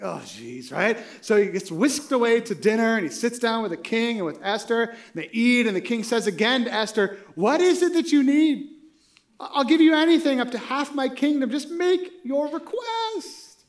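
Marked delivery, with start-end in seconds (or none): 0.0-0.7 low, soft dismayed "oh jeez"; much lower, quieter mock groan of dread. Haman realizes his fate. 7.3-13.6 higher, louder kingly offer, slowing at the end; louder, higher voice of an indulgent king; generous king. Retold or imitated. King offers Esther anything.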